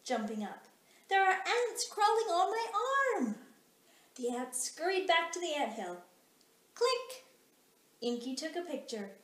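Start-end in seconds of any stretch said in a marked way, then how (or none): 1.1-3.5 higher, louder frightened line; little girl alarmed by ants; panicked girl. A narrator's reading. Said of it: "shouted the little girl". 6.7-7.2 high sharp click; camera click. A narrator's reading. Camera sound in story.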